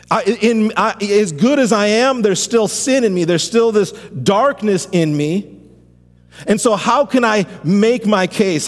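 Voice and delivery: fervent pastor preaching Job's cry; preaching at full force. Acted. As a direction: preach it out, rising to a climax. Builds sermon over music.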